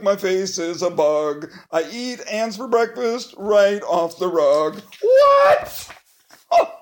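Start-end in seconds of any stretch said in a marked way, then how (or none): 5.0-6.7 very high, loud "What?" shooting up; shocked shriek. Pretend. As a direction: squeal it in total disbelief. Reader shocked again.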